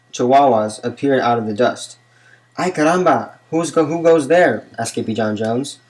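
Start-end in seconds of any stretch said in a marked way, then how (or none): 2.6-4.6 startled. A narrator's reading. Kitten startled by strangers.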